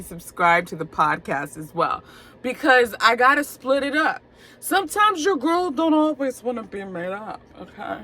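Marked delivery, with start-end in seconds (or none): 4.6-8.0 put-on sassy, higher "your girl" voice; a touch of sass. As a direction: throw it out with attitude. Sassy aside about podcast voice.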